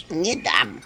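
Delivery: low grumbling refusal; grumble. Acted. House spirit grumbling a refusal. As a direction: grunt it out stubbornly. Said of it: "буркнул домовой".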